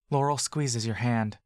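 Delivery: ordinary delivery, nothing marked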